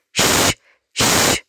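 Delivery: "Shh" as an unvoiced hushing sound; two drawn-out hushes, "Shh, shh"; quiet shh sound. Shown as a demonstration. Teacher reminding children of library quiet, hushing shh. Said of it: "you have to be quiet".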